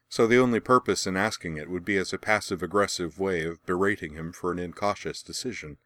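ordinary delivery, nothing marked

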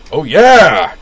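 loud high cheer; shouting. A narrator's reading. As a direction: whoop it out. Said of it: "Utana shouted".

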